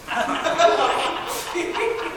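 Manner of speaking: breaking into soft laughter; real laughter. Real, not acted. Reader cracking up at the goose egg, soft laughter. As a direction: laugh helplessly at the joke.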